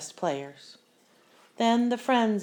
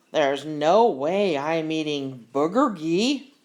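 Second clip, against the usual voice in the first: louder, slower, scornful voice; mocking refusal; 2 semitones lower, 6 dB louder, slower than usual. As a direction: sneer at the food. Kid mocking new boy's food.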